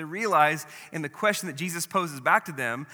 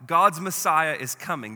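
louder, slow herald's cry; a preacher's rising proclamation; proclaiming call; 4 semitones higher, 7 dB louder than usual. Retold or imitated. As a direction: call it out like John in the wilderness. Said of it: "he cried out".

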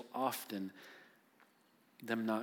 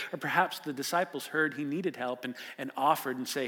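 ordinary delivery

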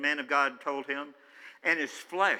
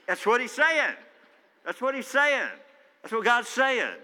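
high, loud declaration, pressing the point home; emphatic proclamation; 8 semitones higher, 6 dB louder than usual. Real, not acted. Preacher to congregation, loud emphatic proclamation. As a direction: hammer the answer to the crowd. Answering his own question loudly.

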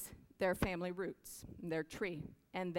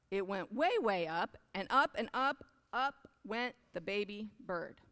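storybook reading; 4 semitones higher than usual. A narrator's reading. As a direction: read it like a bedtime picture book. Reads picture book aloud.

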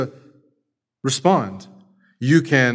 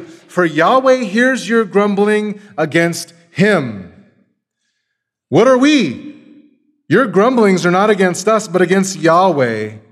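Moses rebuking; 6 semitones higher, 7 dB louder than usual. Acted. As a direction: thunder it like Moses scolding the people. Preacher voicing Moses rebuking Israel.

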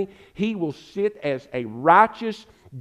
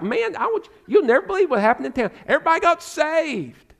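much higher, fast, breathless excitement; excited townsman; 9 semitones higher than usual. Acted. Villager telling of Jesus' arrival, voiced high and excited. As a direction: gush like a man bursting with news.

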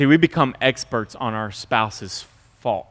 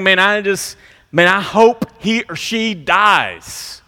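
slow, higher, bitter wish; resentful spouse; 6 semitones higher, 4 dB louder, slower than usual. Retold or imitated. Spouse in bed wishing partner dead, slow bitter. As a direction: mutter the wish lying in bed. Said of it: "you're wondering".

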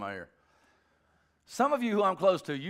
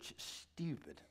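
"stupid" with a drawn sh, much softer; hushed "stupid"; 14 dB quieter, slower than usual. Shown as a demonstration. Father corrects how he said it, hushed "stupid". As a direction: hush the word "stupid" after a sh start. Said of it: "I didn't say stupid, I said".

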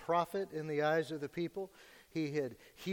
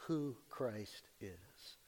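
slow and solemn; 6 semitones lower, 9 dB quieter, much slower than usual. Real, not acted. Slowed, solemn close.

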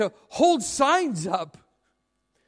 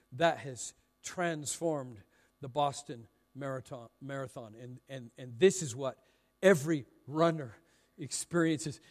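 lower, softer, grave telling; solemn sermon delivery; 9 semitones lower, 8 dB quieter than usual. Real, not acted. Preacher recalling the Boston Marathon bombing, solemn. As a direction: drop down soberly for tragic news.

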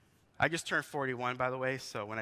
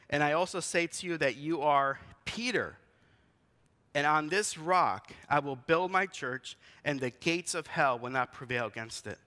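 scripture read; 5 dB louder than usual. Acted. Quoting Jesus from scripture.

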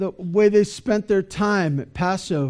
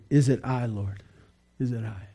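hushed, low, sorrowful question; 5 semitones lower, 6 dB quieter than usual. Retold or imitated. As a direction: ask it softly, sinking into sorrow. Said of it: "they were sorrowful and began to say".

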